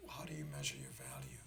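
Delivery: usual speaking voice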